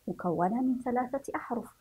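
ordinary talk

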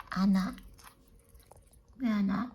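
normal talking voice

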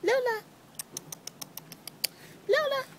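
sing-call her name across the yard. Real, not acted. Calling the cat.

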